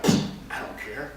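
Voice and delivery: soft, whispery brush-off; dismissive mock indifference. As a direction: shrug it off under your breath. Acting out indifferent bystanders.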